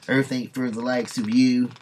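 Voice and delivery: a lightly voiced, loud hillbilly taunt. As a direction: spit it at her like a bigot. Redneck threatens her.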